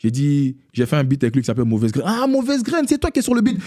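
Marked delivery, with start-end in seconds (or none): 2.1-3.6 high-pitched imitation of an excited fan. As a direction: act out a fan's sudden recognition, pitched right up. Retelling a fan's recognition.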